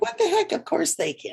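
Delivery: a high mock indignant cat voice; voicing the cat. Voicing her cat wanting in on the streams, high mock indignation. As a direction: jump up high, playful cat outrage. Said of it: "and it's like".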